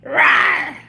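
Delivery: loud playful roar; mock roar. Streamer acting out scaring a friend away. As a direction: roar to scare them off. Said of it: "I was like".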